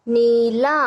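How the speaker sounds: नीला with ee and aa drawn out; long vowels. Shown as a demonstration. Teacher sounding out a spelled word for children, long vowels. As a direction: stretch both syllables of नीला. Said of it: "इसका उच्चारण थोड़ा लंबा".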